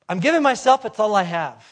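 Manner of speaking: loud declaration, starting high and dropping; retold bold declaration. Little boy standing in the offering plate, bold declaration. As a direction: proclaim it as the boy offering himself.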